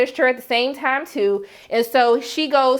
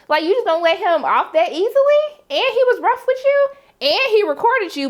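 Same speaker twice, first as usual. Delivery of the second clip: high, rising disbelief; incredulous indignation; 8 semitones higher, 3 dB louder than usual. Acted. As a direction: pile up the outrage, pitch climbing high. Indignant at Jules letting him off.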